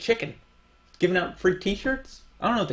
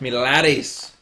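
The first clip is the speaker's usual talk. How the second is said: mock Scottish accent; 6 semitones lower, 4 dB louder, slower than usual. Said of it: "It sounds like you're doing Scottish".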